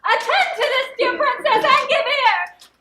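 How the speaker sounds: high, very loud, crazed declamation; madman's reading. Acted. Clown reading a madman's letter to his lady, crazed proclamation. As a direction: proclaim it madly, stretching the last words. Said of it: "to read it in my right mind is to read it like this".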